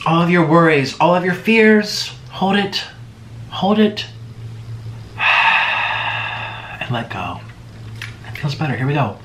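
calm meditation coach voice; guided breathing. Acted. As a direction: lead them gently through each breath. Leads deep breathing.

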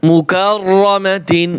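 মুকাররামাতিন slowly, with a long rolled rr and "tin"; full unpaused form. Shown as a demonstration. Teacher giving the word before waqf, slow full ending. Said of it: "ওয়াকফ করলে কি উচ্চারণ হবে?".

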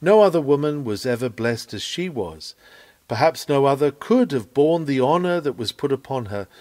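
ordinary talk, nothing marked

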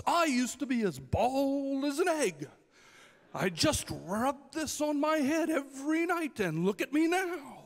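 loud, high carnival huckster pitch; snake oil seller. Retold or imitated. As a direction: sell a miracle cure to the crowd. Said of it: "he would say something like this".

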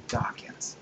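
whispered punchline. Real, not acted. Punchline answer to own question.

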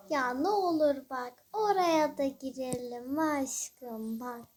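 slow, drawn-out wheedling; pleading. Pretend. Begging friends to go into a shop, pleading. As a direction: beg with long stretched vowels.

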